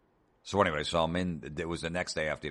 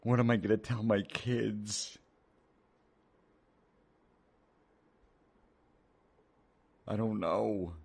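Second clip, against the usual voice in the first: slow, higher whining lament; a lightly played fretting parent; mock whiny parent; 5 semitones higher, slower than usual. Mocking whiny parents again.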